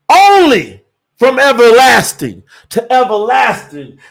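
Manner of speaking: high, rising preacher's cry, slowing to the end; full-force preaching. Acted. Preacher asking who talks like this, full-force preaching. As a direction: proclaim it to the congregation.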